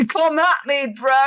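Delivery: laughing, bellowed taunt; shouted taunt. Pretend. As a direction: shout it like a bro picking a fight.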